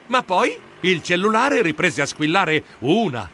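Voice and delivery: ordinary delivery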